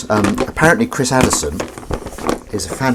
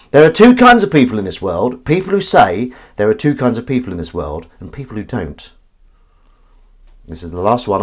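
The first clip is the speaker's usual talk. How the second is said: ordinary talk, nothing marked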